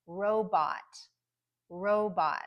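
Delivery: robot with strong RO and clear short O in bot; careful teacher's robot, both vowels full; first-syllable primary stress. Shown as a demonstration. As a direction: stress the start of robot, keep bot unreduced. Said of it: "the stronger syllable is the first one".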